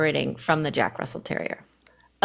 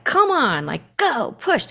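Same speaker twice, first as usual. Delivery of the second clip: pushing coach voice; 6 semitones higher, slower than usual. Retold or imitated. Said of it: "it's that, like".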